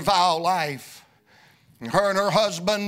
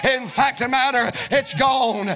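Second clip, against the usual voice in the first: preaching at full force; 3 semitones higher than usual. Acted. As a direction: preach it like a tent revival, pushing every line. Revival preaching climax.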